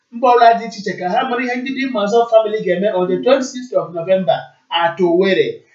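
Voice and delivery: ordinary talk, nothing marked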